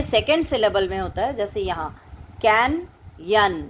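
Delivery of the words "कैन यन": ordinary talk, nothing marked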